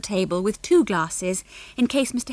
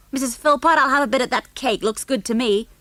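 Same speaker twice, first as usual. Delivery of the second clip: put-on pushy American boy; 5 semitones higher, 4 dB louder than usual. Junior demanding cake.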